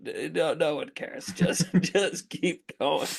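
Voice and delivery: laughing, slow mock plea; slow, amused reassurance to keep going; imitating the crew. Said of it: "It's just like".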